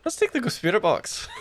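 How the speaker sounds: high-pitched attempt at Scottish; bad Scottish accent. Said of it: "that is a really bad accent".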